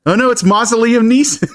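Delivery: high-pitched pretend panic; mock alarm. Co-host reacting to his own bit, mock alarm. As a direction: cry out in hammed-up dread.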